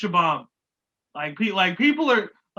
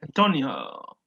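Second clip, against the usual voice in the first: imitated Latin accent, a little low; 4 semitones lower than usual. Co-host mimicking how a contestant says Antonio, mock Latin accent. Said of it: "Latin accent going on every time".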